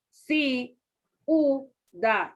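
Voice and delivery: CIUDAD chopped into three syllables, ci, u, dad; slow syllable-by-syllable CIUDAD with I and U apart; wrong syllable split. Shown as a demonstration. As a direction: say CIUDAD as si... u... da, pausing between. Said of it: "no puede poner".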